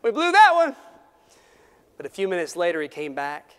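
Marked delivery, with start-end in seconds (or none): 0.0-0.8 put-on high, loud boyish groan; child's voice, high and loud; mock dismay. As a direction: blurt it like a kid caught out. Boy's rueful line in story.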